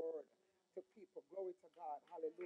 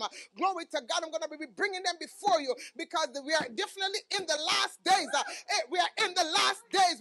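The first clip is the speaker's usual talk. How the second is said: high, shouted sermon climax; loud, urgent exhortation at full pitch; full-force preaching; 11 semitones higher, 21 dB louder than usual. Real, not acted.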